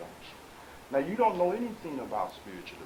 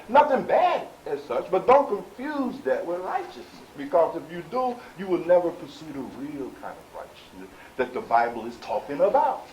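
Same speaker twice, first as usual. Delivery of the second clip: raised, forceful sermon warning; impassioned preaching; 6 semitones higher, 9 dB louder than usual. Real, not acted. Preacher warns congregation not to confuse ritual with righteousness, impassioned preaching. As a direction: press the warning home in a loud, high preaching voice.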